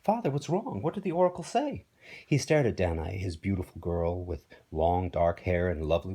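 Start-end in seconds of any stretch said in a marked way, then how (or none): 0.0-1.8 put-on high-pitched young princess; higher, concerned daughter voice; worried daughter. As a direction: anxiously question your father. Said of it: "his daughter came to see him".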